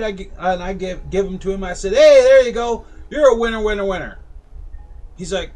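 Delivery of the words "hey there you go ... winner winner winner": high, much louder carnival cheer; loud barker call. Retold or imitated. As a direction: shout it like a fairground barker. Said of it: "my whole rigmarole".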